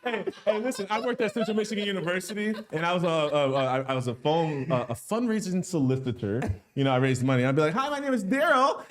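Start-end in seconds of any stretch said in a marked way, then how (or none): 0.0-2.5 laughing. Real, not acted. 7.7-8.8 bright high phone-caller greeting, much higher. Retold or imitated.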